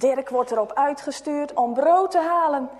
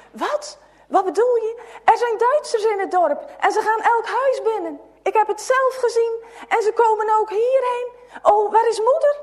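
high, loud, urgent fear; children in a panic; frightened; 7 semitones higher, 5 dB louder than usual. A narrator's reading. Children panicking over soldiers.

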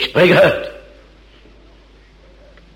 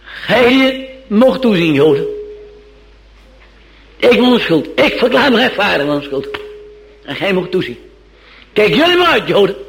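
Pilate warning; 7 semitones higher, 3 dB louder than usual. Acted. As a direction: hurl the warning at the crowd. (Pilatus waarschuwt de Joden hoog en luid)

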